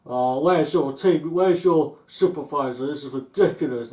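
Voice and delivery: an irate customer demanding the supervisor. Retold or imitated. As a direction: demand the boss, fuming.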